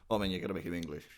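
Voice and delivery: normal talking voice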